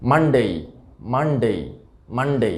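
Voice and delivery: মানডে ending in a gliding "dey"; full ei diphthong closing মানডে; correct pronunciation. Shown as a demonstration. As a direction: say মানডে as "MUN-day". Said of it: "সঠিক উচ্চারণটা".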